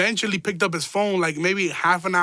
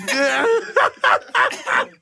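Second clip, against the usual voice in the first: high, loud laugh; burst of laughter; 4 dB louder than usual. Real, not acted. Storyteller cracking up at his own tale, high laughter.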